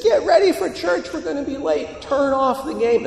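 high-pitched mock mom nagging; exasperated mother. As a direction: imitate a mother hollering at kids who ignore her. Mother orders ignoring kids once more.

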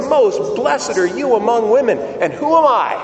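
high-pitched acted exclamation of blessing; joyful cry. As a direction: cry out as if overjoyed by a visitor. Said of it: "crying out in a loud voice".

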